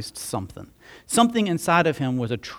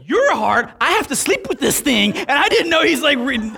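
higher, laughing mock mother's outrage; incredulous mom, laughing; 11 semitones higher, 6 dB louder than usual. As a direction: play the mom, half laughing in disbelief. Mom's incredulous retort, laughing.

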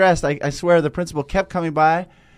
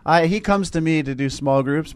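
ordinary talk

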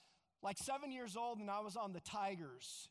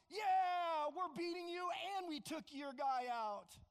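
higher, loud gloating taunt; defiant taunt; 6 semitones higher, 4 dB louder than usual. Retold or imitated. Boy taunting the other team after the hit, defiant gloating. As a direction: rub it in the losers' faces. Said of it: "He was defiant".

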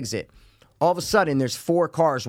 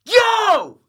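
high loud yell of yo; scream; 8 dB louder than usual. Retold or imitated. Yelling at kneeling protesters, loud high yell. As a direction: belt it out across the street. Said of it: "And I just scream".

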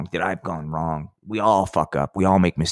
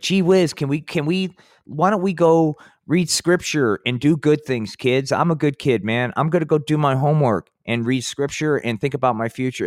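put-on higher-pitched, earnest little-kid voice; goody-goody kid; 6 semitones higher than usual. Third grader talking his friends out of mischief, goody-goody. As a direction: play an eager good boy, pitched up.

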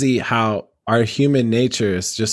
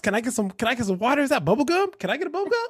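overeager passenger; 13 semitones higher, faster than usual. Acted. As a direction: play a needy passenger begging the driver for freebies. Acting a pushy Uber passenger.

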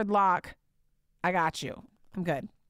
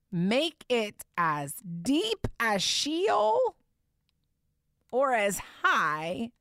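very slow, higher, stretched Sheol; emphatic preaching; 5 semitones higher, much slower than usual. Acted. Preacher repeating the verse, very slow and higher. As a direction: stretch "Sheol" out long and high.

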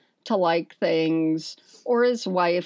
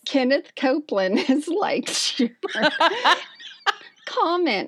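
laughing while reading; 6 semitones higher than usual. Retold or imitated.

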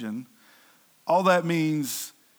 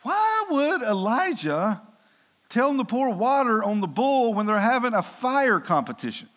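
baffled; 6 semitones higher than usual. Real, not acted. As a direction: ask it in puzzled disbelief, stretching the opening words. Preacher poses a baffled rhetorical question.

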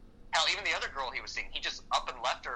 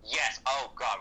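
normal talking voice

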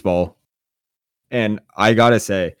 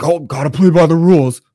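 loud, higher-pitched mocking imitation; mock retort; 7 semitones higher, 6 dB louder than usual. First baseman answers the batter's complaint, mocking retort. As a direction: throw it back as a whiny-rival impression.

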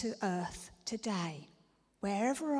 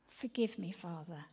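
ordinary talk

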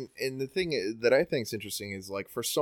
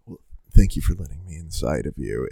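creepy host sign-off; 6 semitones lower, 8 dB louder than usual. Retold or imitated. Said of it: "really semi-creepy".

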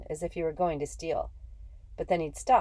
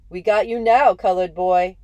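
taunting shout; 3 semitones higher, 12 dB louder than usual. Acted. Fielders taunting in a rundown.

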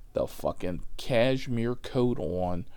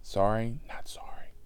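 whispered aside. Real, not acted. Comic half-apologizing to listeners, whispered aside. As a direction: whisper it as a throwaway. Muttered aside after admission.

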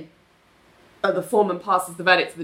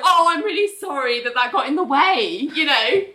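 mock apology; 7 semitones higher than usual.

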